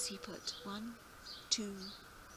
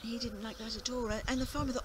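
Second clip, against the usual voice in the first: usual speaking voice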